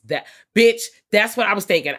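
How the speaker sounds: loud and high, fired up; excited. Real, not acted. Recapping a horror movie to co-host, excited loud outburst. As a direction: blurt it out, hyped.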